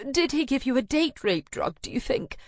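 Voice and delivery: high, catching, breaking voice; voice cracking. Acted. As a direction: choke on the horror. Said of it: "her voice catching and cracking".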